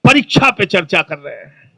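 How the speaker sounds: a hushed gush about "परीक्षा पे चर्चा"; mock tearful admirer. Imitating a weepy admirer who whispers "परीक्षा पे चर्चा कर रहे हैं". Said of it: "कई लोग रोने लगते हैं".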